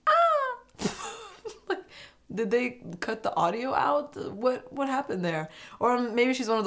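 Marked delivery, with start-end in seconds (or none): 0.1-0.6 a small high yelp imitating her; tiny mock scream. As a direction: give a barely-there little squeak. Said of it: "she barely screamed".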